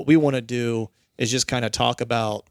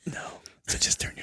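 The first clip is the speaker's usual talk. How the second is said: whispering; 7 dB quieter than usual. Pretend. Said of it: "talk like this the whole time".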